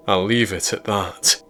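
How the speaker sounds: ordinary delivery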